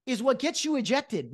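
ordinary delivery

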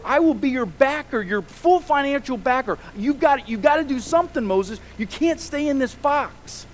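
a father who has given everything, losing patience; exasperated father building up. Acted. God as father scolding a reluctant Moses, building to a frustrated plea.

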